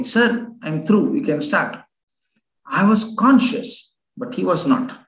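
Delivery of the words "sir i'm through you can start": usual speaking voice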